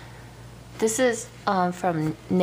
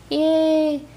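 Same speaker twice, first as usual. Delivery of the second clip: excited; 8 semitones higher, 5 dB louder than usual. Real, not acted. Cheering at new bag.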